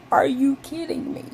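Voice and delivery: high, laughing repeat of the outrage; disbelieving laugh at the bird; laughing disbelief. Retold or imitated. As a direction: laugh through it at the absurdity. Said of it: "And I was like".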